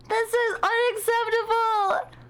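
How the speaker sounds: slow, high hammed-up indignation; mock outrage. Streamer hamming up outrage to chat about missing out, slow and high. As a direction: drag out each word in fake outrage.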